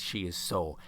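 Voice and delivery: low, slow, deliberate words; exasperated dislike. Real, not acted. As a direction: state your dislike with heavy finality. Vents irritation at Sierra.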